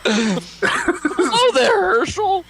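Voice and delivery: high, slow clown greeting. Acted. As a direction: drag it out like a goofy clown. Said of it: "clown-like".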